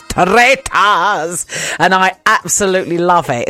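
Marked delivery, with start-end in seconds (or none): 0.0-1.5 put-on theatrical Scottish accent, drawn out with rolled r; hammed-up Scottish trill; Alan Cummins's Scottish accent. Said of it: "he's putting on a Scottish accent when he goes".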